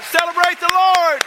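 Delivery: loud, high praise call to the crowd; preaching at full force. Acted. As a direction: rouse the church to clap and praise. Worship leader hypes crowd.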